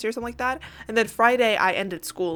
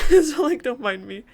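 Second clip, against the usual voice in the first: ordinary delivery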